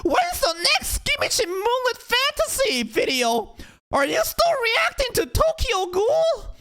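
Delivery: high, slow, nasal-free mock fan whine; mocking demanding fan. Demanding fan asking the creator for videos, high slow mock whine. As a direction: whine it like an entitled commenter. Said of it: "a comment here saying".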